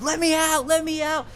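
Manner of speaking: put-on trapped kid yelling while throwing things at the clerk; frantic yelling.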